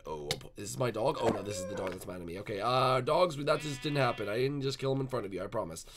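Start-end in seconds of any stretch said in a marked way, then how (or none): none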